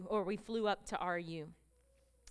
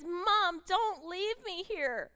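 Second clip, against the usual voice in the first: high, louder pleading as the son; son's plea; 8 semitones higher, 5 dB louder, much slower than usual. Retold or imitated. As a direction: beg not to be left behind. Son begs mother at rehab.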